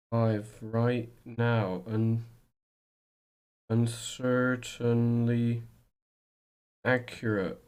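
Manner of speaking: very slow, stretched words; slow, measured mock announcing; drawn-out announcement. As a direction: draw out each word with pauses. Slow announcement while setting up the stream.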